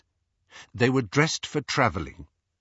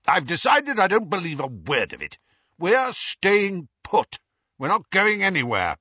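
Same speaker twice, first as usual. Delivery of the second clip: stubborn uncle; 9 semitones higher than usual. A narrator's reading. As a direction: plant your feet and insist. Vernon stubborn declaration.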